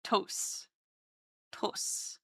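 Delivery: tos whispered, unvoiced. Shown as a demonstration. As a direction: breathe out tos. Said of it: "accentuate that it's a tos".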